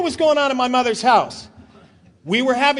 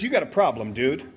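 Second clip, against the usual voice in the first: low, softer buddy voice; buddy confronting him; 12 semitones lower, 5 dB quieter than usual. Retold or imitated. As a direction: lightly voice a friend, pitched down. Retold buddy confronting him at door.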